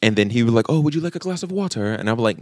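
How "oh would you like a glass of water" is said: lightly mocked proper English accent, higher and softer; fake Queen's English. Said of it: "super proper with the Queen's English".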